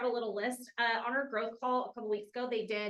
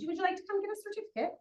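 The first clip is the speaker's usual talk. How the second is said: lightly put-on British accent, higher and quick; 7 semitones higher, much faster than usual. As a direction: ask brightly in a playful British accent. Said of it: "continue in that British accent".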